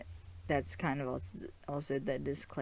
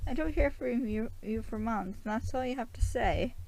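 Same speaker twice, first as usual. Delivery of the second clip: much higher, louder exasperated scolding; concerned and pissed; 10 semitones higher, 5 dB louder than usual. Retold or imitated. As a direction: snap back at him, hurt and fed up. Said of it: "she's both concerned and pissed at him".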